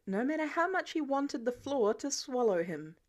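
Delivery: ordinary delivery, nothing marked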